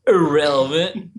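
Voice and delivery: a terrible movie-villain impression, hammed up; Colin Farrell. Host mocking a film lawyer objecting in court, terrible impression. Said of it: "terrible Colin Farrell impression".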